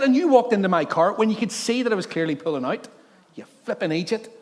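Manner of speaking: high, loud telling-off; mock angry rant. Pastor ranting at the dog walker, mock angry. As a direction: let rip at him. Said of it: "I said".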